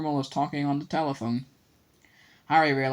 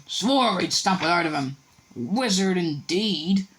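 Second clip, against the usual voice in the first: higher, disdainful scoff; scornful uncle; 5 semitones higher, 4 dB louder, slower than usual. A narrator's reading. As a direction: sneer it out with contempt. Vernon scornful.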